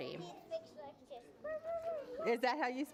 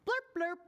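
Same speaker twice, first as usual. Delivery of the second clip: short high blurps; fish blurps; 8 semitones higher than usual. Acted. Boy replying to fish, high blurps. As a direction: blurp in quick fish talk.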